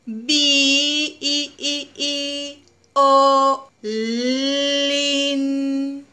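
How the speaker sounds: "Vi, i, i, i, o, lin" with each vowel drawn out; stretched vowels. Shown as a demonstration. Teacher to child, stretching the vowels of violín. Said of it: "busquemos las vocales".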